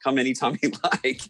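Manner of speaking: giggly invitation. Retold or imitated. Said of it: "We said".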